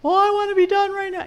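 put-on high, loud protesting child; a kid refusing the break, pushing back loud; whiny protest.

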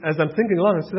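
normal talking voice

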